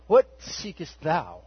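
slow, drawn-out hallowed intoning; ethereal King James. Retold or imitated. Jesus speaking to the disciples, slow ethereal King James voice. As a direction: float the words slowly, otherworldly. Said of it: "You know, very ethereal".

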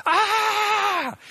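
long, very high, loud scream; full-lung scream. Retold or imitated. Man on stage at rehearsal breaking down, screaming at the top of his lungs. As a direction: scream as loud as you can. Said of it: "screaming at the top of my lungs".

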